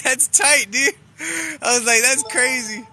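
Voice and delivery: high, loud, laughing through the words; laughing excitedly. Real, not acted. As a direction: laugh with delight as you tell the ending. Retelling the happy ending, laughing.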